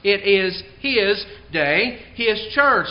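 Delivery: slow, HIS set apart each time; emphasis on HIS. Real, not acted. Preacher urging emphasis on Christ, stressing HIS. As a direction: punch each HIS before day and church. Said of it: "put the emphasis on Him".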